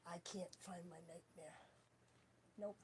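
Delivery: very soft, half-whispered mumble; muttering to self. Real, not acted. Hunting for a missing Nightmare disc, muttering to self. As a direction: murmur it under your breath while searching.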